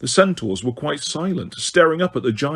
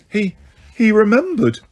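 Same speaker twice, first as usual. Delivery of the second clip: frightened; 7 semitones higher, 4 dB louder than usual. A narrator's reading. Hermione shocked, near faint.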